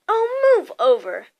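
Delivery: exasperated order; snarling. A narrator's reading. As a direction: push them aside with your voice. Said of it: "Hermione snarled".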